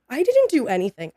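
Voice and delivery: mock-incredulous self-defence; indignant protest. Retold protest to angry dad.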